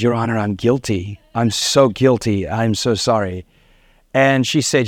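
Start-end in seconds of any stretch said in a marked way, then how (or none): 0.0-3.5 head bowed, humbly sorry; contrite plea. Retold or imitated. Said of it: "I bowed my head".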